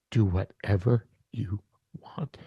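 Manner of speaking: breathy whisper of surrender; whispered prayer. Retold or imitated. To God on the stump, whispering surrender. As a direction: whisper it, giving up control.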